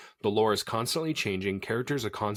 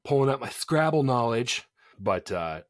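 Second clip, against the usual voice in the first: ordinary talk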